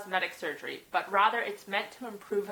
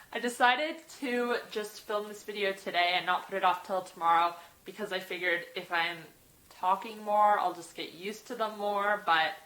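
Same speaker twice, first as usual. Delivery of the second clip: ordinary talk, nothing marked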